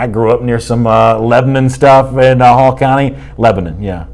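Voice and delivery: ordinary delivery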